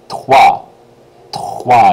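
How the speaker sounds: "toi" with a throaty rolled R after the t, loud; French "toi" for three, R rasped in the throat; rolled R trois. Shown as a demonstration. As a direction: roll the R inside "toi". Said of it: "you gotta roll that R sound".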